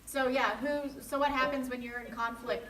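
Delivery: projecting loudly so the remote listener hears. Real, not acted. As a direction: say it out loud and clear for the far end of the line. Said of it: "I've got the loud voice".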